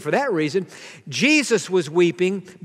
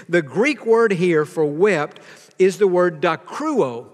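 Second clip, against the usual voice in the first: normal talking voice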